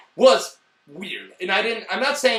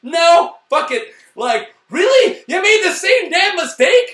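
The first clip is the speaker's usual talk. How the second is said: loud and much higher, slowing on really; real fed-up outcry; exasperated yelling; 11 semitones higher, 9 dB louder, slower than usual. Real, not acted. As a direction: throw up your hands and shout at the screen. Exasperated rant continues.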